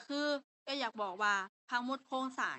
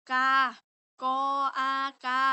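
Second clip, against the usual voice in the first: slow spelling demo; 3 semitones higher, 9 dB louder than usual. Shown as a demonstration. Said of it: "ก็คือ".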